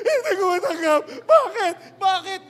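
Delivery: very high, loud wailing cries; hysterical sobbing. Acted. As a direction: widow breaking down screaming why. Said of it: "nag-hysterical".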